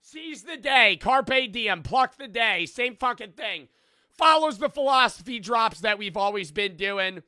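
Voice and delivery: high, loud, pumped-up sales pitch; over-the-top promo read; hype merch ad. Acted. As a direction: sell the merch like a yelling hype man. Hypes his merch drop.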